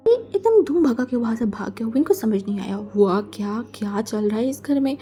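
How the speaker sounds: plain reading voice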